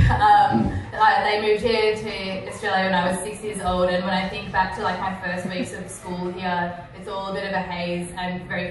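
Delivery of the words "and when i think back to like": ordinary talk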